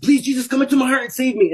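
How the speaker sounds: desperate plea. Retold or imitated. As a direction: plead quickly, at a high pitch. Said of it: "I said".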